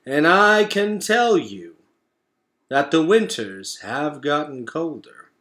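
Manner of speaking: grave elder warning; slow old turtle. Acted. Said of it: "Craig the turtle spoke slowly".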